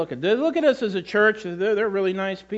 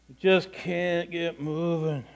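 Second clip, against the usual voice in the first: slow motion; 5 dB quieter, slower than usual. Acted. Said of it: "in slow motion".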